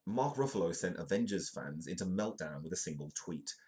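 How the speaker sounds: ordinary talk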